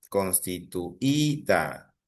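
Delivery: "Constituida" very slow, the Í set apart and held; stress on I. Shown as a demonstration. Teacher proves the word is grave, "Constituida" slow with long Í. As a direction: break "Constituida" down slowly, leaning on Í before -da. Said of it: "Es una palabra grave".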